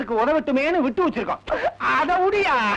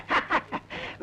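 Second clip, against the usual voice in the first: breathy laugh; 5 semitones higher, 8 dB quieter than usual. Acted. Laughs after joke.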